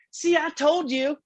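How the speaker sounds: high, drawn-out mock West Virginia girl; terrible West Virginia accent. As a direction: imitate a West Virginia girl's told-you-so, slowly. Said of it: "terrible West Virginia accent".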